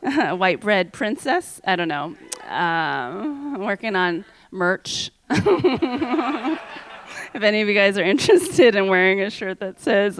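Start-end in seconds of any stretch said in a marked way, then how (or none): 5.3-7.3 a burst of laughter at her own idea; laughing at own joke. Real, not acted.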